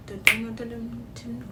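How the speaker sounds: ordinary talk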